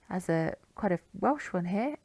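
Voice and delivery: usual speaking voice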